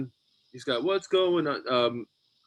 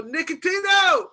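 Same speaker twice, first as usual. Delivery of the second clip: slow, high, loud shout, much higher and louder; yelled name; 5 dB louder than usual. Retold or imitated. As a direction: drag his name out to call him back up. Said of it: "and then I go".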